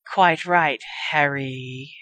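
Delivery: drawn-out, sinking final word; trailing, hissed menace. A narrator's reading. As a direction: let the name trail off low and long. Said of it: "The words trailed off into a long hiss".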